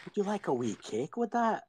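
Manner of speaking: put-on Scottish accent. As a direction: offer the cake like a Scottish tea lady. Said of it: "Like when I went to, uh, Scotland".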